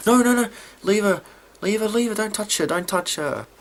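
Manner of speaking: high, urgent rush of repeats; frantic mock command. Imitating a girl pulling a gun on Carol, frantic high warning. Acting Lizzie with gun drawn.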